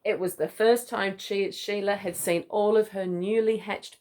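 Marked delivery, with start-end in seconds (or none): none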